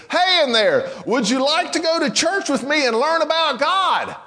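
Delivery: high-pitched holler into a little box; one last emphatic, high-pitched call; insistent calling out. Acted. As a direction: call down to someone hidden inside, pitched right up. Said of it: "he gets way down next to the".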